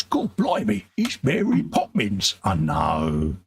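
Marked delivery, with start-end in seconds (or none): 0.0-2.3 high mock Cockney "God blimey", hammed up; lightly voiced bad Cockney "God blimey" like a chimney sweep; bad Cockney accent. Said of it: "chimney sweeps with bad Cockney accents".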